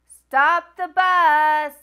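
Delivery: loud, slow drawn-out command; crying out. Acted. Teacher shouting to the driver to stop, loud urgent call. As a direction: yell it to the driver. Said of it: "Miss Glass cries".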